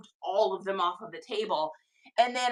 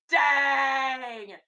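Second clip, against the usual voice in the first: amazed shout. Real, not acted. Streamer reacting to a viewer's haul, amazed shout. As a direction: blurt out amazement at a lucky find.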